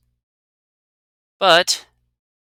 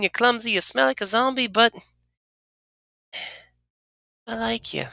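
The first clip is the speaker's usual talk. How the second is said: higher, halting character confession; shy confession; 12 semitones higher than usual. Acted. Anna confessing her liking to the player, higher and halting. Voicing companion Anna in-game.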